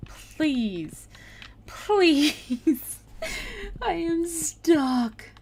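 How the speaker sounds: real whiny, exasperated plea; exasperated pleading. Real, not acted. Player stuck behind a dog, exasperated pleading. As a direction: beg the dog, at the end of your patience.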